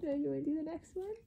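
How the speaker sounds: ordinary talk, nothing marked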